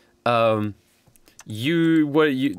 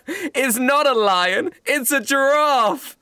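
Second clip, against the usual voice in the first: high, slow exasperated correction; exasperated man; 12 semitones higher, much slower than usual. Pretend. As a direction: correct him, slowly and pointedly. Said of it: "the man turns around and says".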